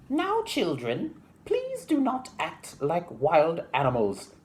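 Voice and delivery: very high, slow schoolmistress voice; prim teacher horse. Acted. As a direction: scold the children primly from up high. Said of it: "says Miss Horse".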